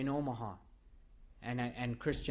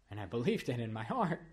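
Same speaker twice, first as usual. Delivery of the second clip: normal talking voice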